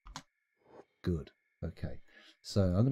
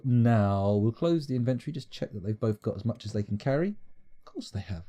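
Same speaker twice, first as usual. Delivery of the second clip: ordinary talk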